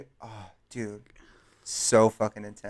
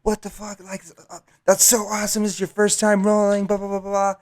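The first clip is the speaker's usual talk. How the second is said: higher, fast excited bro voice; hyped party guy voice; 8 semitones higher, faster than usual. Retold or imitated. Storyteller imitating an excited party bro. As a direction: mimic the hyped dealer, pitched up.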